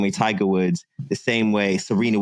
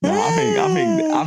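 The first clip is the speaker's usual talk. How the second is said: laughing squeak. Real, not acted. Laughing, voice jumps high.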